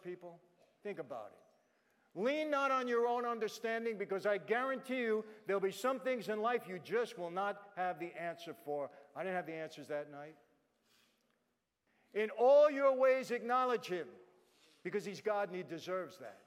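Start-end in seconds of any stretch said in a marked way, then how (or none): 2.1-8.9 preacher reciting scripture; scripture proclamation. Acted. Quoting and preaching. 12.1-14.1 slow, loud, high proclaimed verse; preacher reciting scripture; scripture proclamation. Acted. Quoting Proverbs 3:6.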